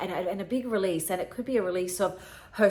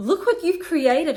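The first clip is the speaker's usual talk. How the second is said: higher, louder burst of pride; heartfelt urging; 10 semitones higher, 8 dB louder than usual. Retold or imitated. Granddaughter showing grandmother the family she built, heartfelt urging. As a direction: make them see it, raised and emphatic.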